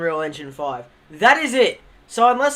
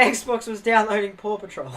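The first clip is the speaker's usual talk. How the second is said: ordinary talk, nothing marked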